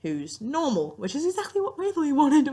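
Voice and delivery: usual speaking voice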